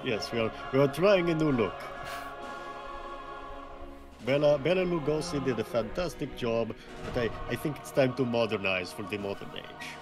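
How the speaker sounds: put-on vampire count voice, Dracula accent. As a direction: speak as a stagey Dracula.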